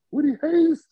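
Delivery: high, loud astonished Woody Hayes?; stunned disbelief. Retold or imitated. Teen recruit amazed the legend is calling, high loud disbelief.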